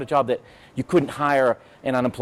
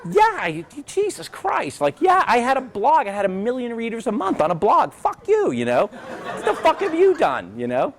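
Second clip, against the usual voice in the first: indignant mock retort; 9 semitones higher than usual. Answering a coworker who asks about Facebook, a high indignant outburst.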